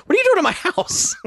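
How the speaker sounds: high, strained put-on shriek; hammed-up cry of a horrified homeowner; mock outrage. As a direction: screech it in comic indignation. Acted homeowner confronting the wall smasher.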